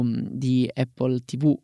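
ordinary delivery

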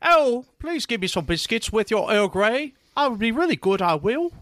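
lightly hammed-up high British voice; mock British accent. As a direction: play a dainty British lady asking for biscuits. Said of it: "I could do some British".

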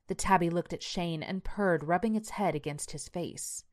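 usual speaking voice

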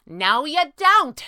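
high, slow, emphatic objection; loud protest. A narrator's reading. Squirrel contradicting her boss, loud protest. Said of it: "she protested".

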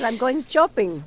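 imitating mom's thick accent, sheets becomes chits; mock immigrant mother accent, going shopping as chopping; affectionate impression of mother's accent; mother's Spanish accent. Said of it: "pero mi mamá habla así".

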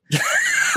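shrieking burst of laughter; high shrieking laugh. Real, not acted. Telling the roommate story, high shrieking laugh. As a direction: cackle out high.

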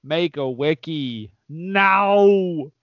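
slow, drawn-out Schwarzenegger voice with a British touch; mild British Arnold Schwarzenegger impression. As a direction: command them slowly like a British Arnie. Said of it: "my classic British Arnold Schwarzenegger impression".

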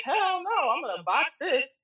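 high, alarmed no way; panicked refusal. Retold or imitated. Telling her mentor she'll botch the reading, high alarm. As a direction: recoil from the idea in a high panic. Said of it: "I was like".

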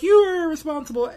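high, loud scolding parent; mock parent yelling. Parent scolding the kids, mock angry yell.

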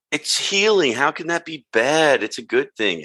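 high-pitched mock naive insistence; annoying character imitation. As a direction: mimic an irritating know-it-all, pitched high. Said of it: "that was really annoying".

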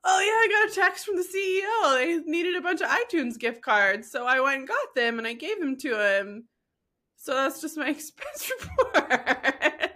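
clueless employee. Retold or imitated. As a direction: play the naive coworker. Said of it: "And they were like".

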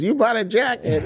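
shocked, high-pitched disbelief; sudden sharp surprise; incredulous. Retold or imitated. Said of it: "She goes".

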